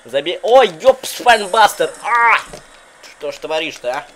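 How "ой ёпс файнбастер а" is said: excited shout. Real, not acted. Calls the move.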